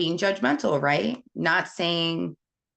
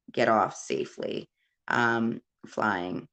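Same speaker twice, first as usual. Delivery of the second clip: ordinary delivery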